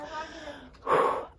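long unvoiced sigh; deep breath out. Shown as a demonstration. Mom demonstrating a calming sigh to her screaming kids. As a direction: show them the calming breath. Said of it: "take a deep breath and go".

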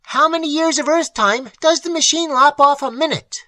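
high, loud, urgent question; shouted question. A narrator's reading. Imbert asking about the machine in the car, shouting. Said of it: "shouted Imbert".

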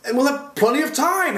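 high, loud character voice; cheerful answer. A narrator's reading. Tok answering the happy humbug, cheerful and high.

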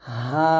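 হা breathed out gently from the throat; soft breathy ha. Shown as a demonstration. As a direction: let হা out as an easy breath. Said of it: "স্বাভাবিকভাবে বলতে হবে".

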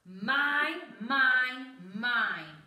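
loud possessive roar, falling away. A narrator's reading. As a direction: roar it out, each word dropping in pitch. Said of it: "they heard a roar".

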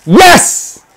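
high, loud shout of joy; triumphant yell. Real, not acted. Gamer yelling with joy at winning the match. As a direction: yell it at the final whistle.

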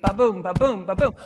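steady "ba-boom", light then heavy; heartbeat rhythm. Shown as a demonstration. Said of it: "because it's our heartbeat".

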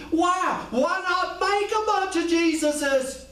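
high slow challenge on "Why not make a bunch of Jesuses?". Shown as a demonstration. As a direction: push the question up to the rafters. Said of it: "this is just me".

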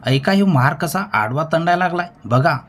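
narrator's contained screech; screeching appeal. A narrator's reading. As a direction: cry out to the crowd in a high voice. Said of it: "Deshmukh किंचाळत म्हणाला".

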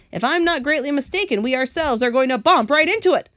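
sharp. A narrator's reading. Centipede warning the others of a coming crash, sharply. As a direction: warn them sharply. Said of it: "the centipede said sharply".

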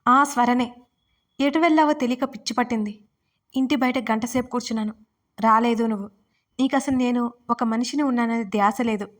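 plain reading voice

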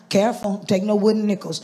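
ordinary talk, nothing marked

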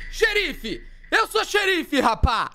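ordinary delivery

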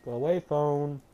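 talking with a mouth full. Real, not acted. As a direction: speak round a bite of burger. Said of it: "Sorry, I was eating a burger".

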